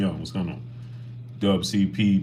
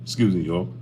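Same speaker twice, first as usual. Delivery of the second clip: ordinary talk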